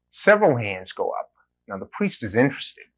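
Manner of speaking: normal talking voice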